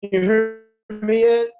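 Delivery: slow, choppy phone voice; line cutting in and out; radio breaking up. Real, not acted. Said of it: "it's pretty broken up".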